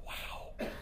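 breathless hushed wow; whispered wonder; awed whisper. Retold or imitated. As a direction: whisper it in amazement. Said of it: "he's like".

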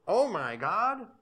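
put-on high, drawn-out childish "Oh my God"; kids' exclamation. Parent mimicking his kids saying it. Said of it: "they would go".

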